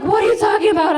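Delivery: high, loud disbelieving yell; a mother shouting at her frightened child; mom's shocked shout. Retold or imitated. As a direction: mom yelling in shock. Mom reacts to pregnancy claim.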